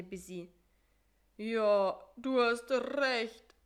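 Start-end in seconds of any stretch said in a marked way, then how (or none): 1.4-3.5 yowling. Acted. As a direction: howl it in misery. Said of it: "jaulte Karl-Heinz".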